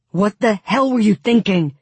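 loud, high-pitched outraged demand; angry bellow. A narrator's reading. Tolia scolds Alina as he sets her down, bellowing. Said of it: "Tolia bellowed".

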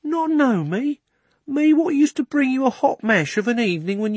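hurt cabby. Acted. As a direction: plead with the horse, hurt.

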